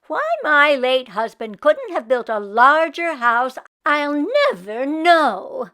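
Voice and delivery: put-on loud, breathy complaining old woman; loud kvetching old woman, partly whispered. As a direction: grumble about the house like a put-upon Yiddish grandma. Meshka complains to the rabbi.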